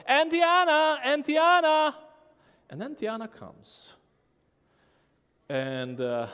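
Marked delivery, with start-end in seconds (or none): 0.1-2.0 loud, high call across the yard; boy shouting for the neighbor. Retold or imitated. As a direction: call her name from far away. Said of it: "And he shouts".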